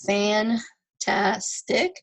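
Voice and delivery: "fantastic" slowly in three beats; teacher breaking "fantastic" into syllables. Shown as a demonstration. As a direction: sound out "fantastic" with short pauses. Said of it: "three more vowels".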